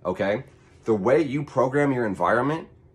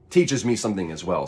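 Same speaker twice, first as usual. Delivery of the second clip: ordinary talk, nothing marked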